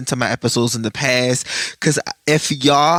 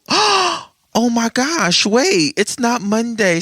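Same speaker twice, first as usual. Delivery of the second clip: startled gasp at remembering the day; sudden realization; 7 semitones higher, much slower than usual. Real, not acted. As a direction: gasp then a high, slow oh my gosh.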